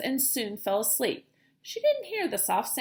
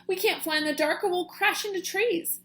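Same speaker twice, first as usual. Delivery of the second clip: worried; 7 semitones higher than usual. Acted. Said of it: "Stellaluna squeaked".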